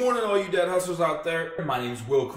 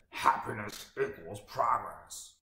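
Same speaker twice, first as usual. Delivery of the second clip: low gravelly Tony Robbins impression; 5 semitones lower, 11 dB quieter, slower than usual. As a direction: growl it like a motivational guru. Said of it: "in his gravelly classic voice".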